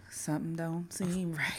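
hushed, wary whisper. Retold or imitated. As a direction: breathe it like a secret. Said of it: "India was like".

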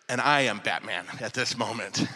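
very low, hushed superhero voice; Batman. Pretend. Storyteller casts himself as hero, low mock Batman voice. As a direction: drop low and soft, mock-heroic.

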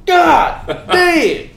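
explosive high-pitched yell; sudden snap. Shown as a demonstration. Coach showing how he suddenly loses it, explosive yell. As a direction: burst out loud and high, each word its own blast. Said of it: "one little thing will happen, and I just".

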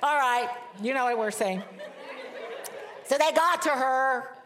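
giggling at her own awkward word; laughing. Real, not acted. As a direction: laugh it off and press on.

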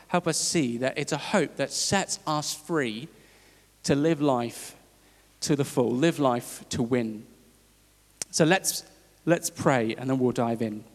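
normal talking voice

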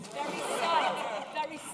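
slow breathy whisper of sorrow; hammed-up sad whisper; mock sad whisper. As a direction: whisper it like a sulking child. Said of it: "I was so disappointed".

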